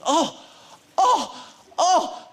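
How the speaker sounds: high, loud, thrilled gasps of "oh"; old fan on the hill spotting the film crew, thrilled; excited old man. Retold or imitated. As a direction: mimic a delighted old fan catching sight of a star. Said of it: "then he starts going".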